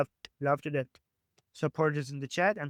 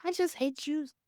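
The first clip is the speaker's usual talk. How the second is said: high mocking voice; mock confession; 8 semitones higher than usual. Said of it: "Just come and say".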